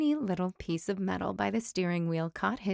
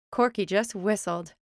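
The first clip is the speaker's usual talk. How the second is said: plain reading voice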